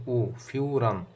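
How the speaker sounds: "উ" alone, then carried into "ফিউরান"; demonstrated u sound. Shown as a demonstration. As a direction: lengthen the u inside "ফিউরান". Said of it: "উ এরকম একটা উচ্চারণ হয়". ("উ" আলাদা বলে "ফিউরান"-এ টেনে উচ্চারণ)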